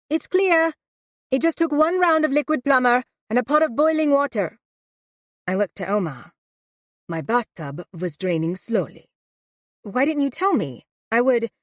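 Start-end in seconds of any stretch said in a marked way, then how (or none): 0.1-4.6 much higher and louder, calling from upstairs; calling down the stairs. Acted. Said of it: "my Aunt Alice calls down". 9.8-11.5 surprised protest. Acted. Louisa caught out by aunt.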